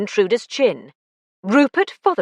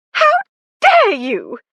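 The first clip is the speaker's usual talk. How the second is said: loud, raised outrage; furious yell; 14 semitones higher, 5 dB louder, slower than usual. A narrator's reading. Lucy yelling at Alec for the trap, loud and outraged. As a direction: hurl it at him in fury. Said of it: "she yelled at Alec".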